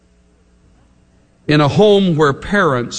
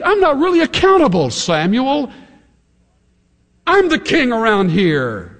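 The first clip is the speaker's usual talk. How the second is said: smug King Saul; 9 semitones higher than usual. Retold or imitated. Preacher paraphrases Saul's excuse.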